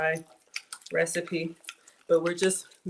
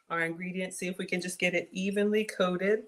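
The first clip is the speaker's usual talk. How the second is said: ordinary talk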